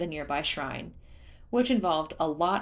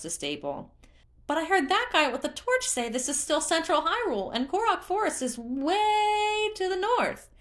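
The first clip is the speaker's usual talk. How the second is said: very high, loud cheer; cheerful creature; 9 semitones higher, 3 dB louder than usual. A narrator's reading. Hestu spotting Link, cheering in a very high voice. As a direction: squeal with glee at a friend.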